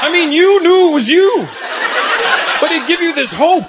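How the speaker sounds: high-pitched, worked-up complaint; comic exasperation. Real, not acted. Comedian complains about dad's vague threat, high and exasperated. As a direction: pitch it way up, protesting the absurdity.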